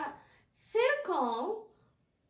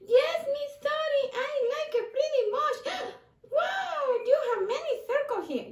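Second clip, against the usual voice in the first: much higher, louder mouse cheer; excited puppet mouse; 8 semitones higher, 4 dB louder than usual. Acted. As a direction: squeal with delight as the mouse. Puppet mouse talks to teacher.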